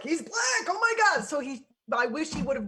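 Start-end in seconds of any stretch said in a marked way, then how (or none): 0.0-1.2 much higher, mock-shocked outcry; hammed-up horrified squeal; mock alarm. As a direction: parody a panicked conservative gasp. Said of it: "the right was like".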